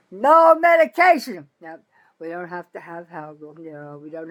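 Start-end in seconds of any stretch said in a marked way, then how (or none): 0.1-1.5 loud, higher angry protest; indignant outburst. Real, not acted. Ranting about being denied medication, loud and indignant. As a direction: throw it back at them, outraged.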